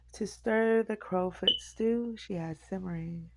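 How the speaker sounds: usual speaking voice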